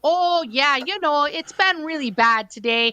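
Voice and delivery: complaining in a hammed-up Midwest accent. [name] griping about the reg office in mock Midwest accent. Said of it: "we would talk to each other like this".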